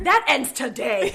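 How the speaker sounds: loud, drawn-out declaration; mock resolve. Telling how she decided to make the first move, mock resolve. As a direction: slowly proclaim it, hammed-up and laughing.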